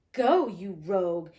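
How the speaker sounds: slow, loud scolding of the rogue; loud rebuke. Acted. Servant scolds the miser, loud and slow. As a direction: bark it at him slowly.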